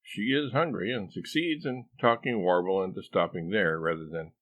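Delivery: plain reading voice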